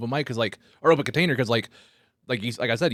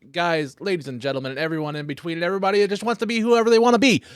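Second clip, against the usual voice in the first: announcer intro; 7 semitones higher than usual. Pretend. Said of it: "I wanna do it again".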